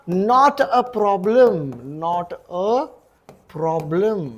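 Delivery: slow, drawn-out model phrase; teacher spelling out the phrase for students, slowly; slow teaching model. Shown as a demonstration. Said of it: "okay".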